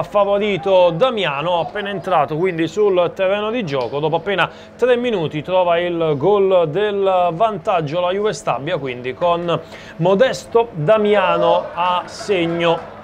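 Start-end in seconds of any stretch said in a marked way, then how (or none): none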